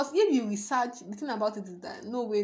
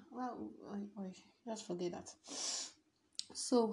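usual speaking voice

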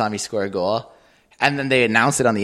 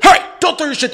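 high, louder mock reprimand; imitated scolding; 7 dB louder than usual. Dad scolds kid for throwing gear, high mock reprimand. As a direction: cut in like a scolding parent.

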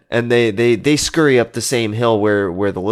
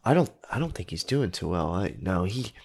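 soft, low worried murmur; hushed aside; 3 semitones lower, 9 dB quieter than usual. Acted. Friends doubting the fallen man's health, hushed. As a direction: drop well under your voice, confiding. Friends confer about the injured man.

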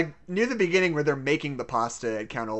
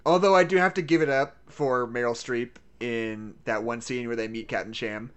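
normal talking voice

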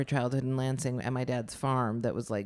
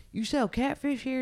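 imitating customers; 9 semitones higher than usual. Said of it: "people would always be like".

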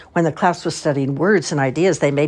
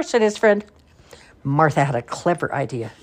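ordinary delivery

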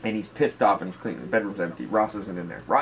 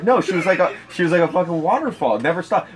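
raised, louder mock-outraged complaint; hammed-up fury at a soaked bed; mock angry rant; 6 semitones higher, 4 dB louder than usual. Friend griping about the wet bed, mock angry. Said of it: "he was, like, mad".

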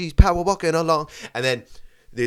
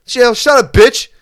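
mock yell; 7 semitones higher, 6 dB louder than usual. Yelling at imagined dog lady.

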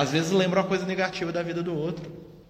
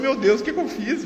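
retold dismay; 8 semitones higher, 3 dB louder than usual. Retells own dismayed reaction.

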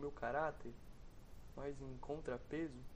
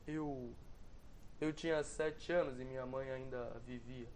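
usual speaking voice

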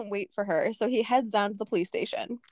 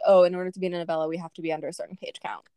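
rushed author voice; 4 semitones lower, faster than usual. Retold or imitated. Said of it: "the author was like".

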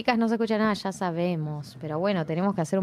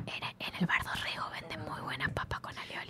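soft mock ASMR whisper; 5 semitones lower, 14 dB quieter than usual. Recommending Bar Dorrego fries to the co-host, whispered. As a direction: whisper a food tip like an ASMR clip.

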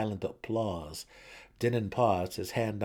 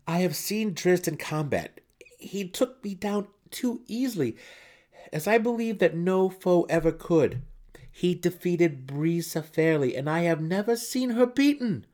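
higher, tense pleading son; anxious young son; 12 semitones higher, 8 dB louder than usual. A narrator's reading. Son pleading with his matron mother. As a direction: plead your case desperately.

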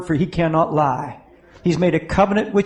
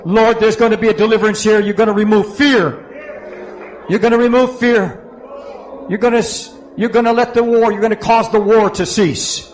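loud, raised preacher's prayer; fervent prayer; 4 semitones higher, 4 dB louder than usual. Real, not acted. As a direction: call on God with force over the people. Prays over the congregation.

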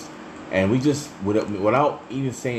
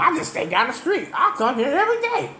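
imitating a local guy. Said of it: "Come on".

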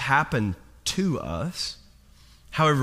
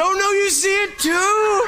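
panicked; 13 semitones higher, 4 dB louder than usual. Retold or imitated. Panicked teen hears mom saw it.

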